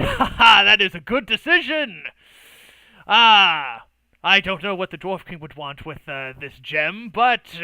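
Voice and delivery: booming laugh into loud, high approval; hearty laughing. Acted. Dwarf approving the decision, loud and laughing. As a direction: burst out heartily, then ease off. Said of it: "kind of laughs a bit".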